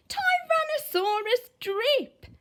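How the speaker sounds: plain reading voice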